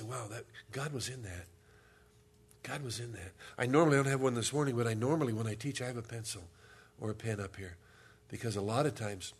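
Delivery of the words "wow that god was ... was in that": hushed awe. Retold or imitated.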